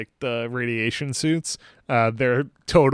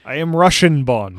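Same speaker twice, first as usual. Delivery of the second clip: louder hammed-up Russian accent; mock Russian accent; 3 semitones higher, 8 dB louder than usual. Co-host echoes Bond's introduction, mock Russian accent. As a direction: play Bond faking a Russian accent. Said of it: "puts on his Russian accent".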